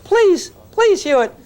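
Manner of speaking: hammed-up please-please begging; mock begging student. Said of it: "how many say".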